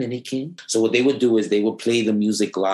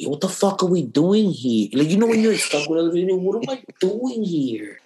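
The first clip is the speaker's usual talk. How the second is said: higher, exasperated mock complaint; 8 semitones higher than usual. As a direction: complain about being stuck there. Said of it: "And I'm like".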